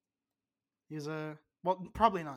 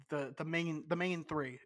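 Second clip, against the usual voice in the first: usual speaking voice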